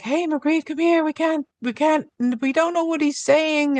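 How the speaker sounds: high-pitched retold plea for help; calling her over.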